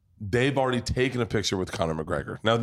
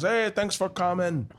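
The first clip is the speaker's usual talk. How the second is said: high, slow, friendly greeting; imitating sweet guy; 8 semitones higher than usual. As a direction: pitch it up, drawn out, as a sweet host. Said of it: "he's the sweetest guy".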